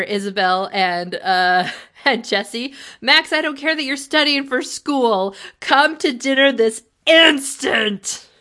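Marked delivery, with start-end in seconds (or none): 3.0-8.3 mock parental command, higher and louder, slowing at the end; hammed-up strict parent order. Imitating parents ordering Max home, stern and loud. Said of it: "and say".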